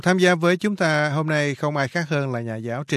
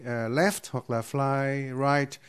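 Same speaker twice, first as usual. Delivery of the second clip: slow demo; much slower than usual. Shown as a demonstration. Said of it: "Fly là bay".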